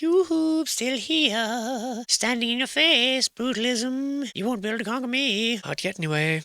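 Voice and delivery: high, soft singsong figure; taunting building voice. Acted. Old building peeking over Brutalism, taunting in high voice. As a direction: call out coyly in a high put-on voice.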